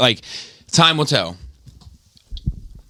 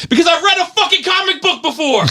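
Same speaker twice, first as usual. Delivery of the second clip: much higher, exasperated outburst; exasperated rant. Real, not acted. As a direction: vent at the overreacting fans.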